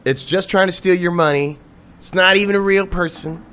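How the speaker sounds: retold rant. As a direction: rail against the bot again, replayed. Retelling his rant at a bot.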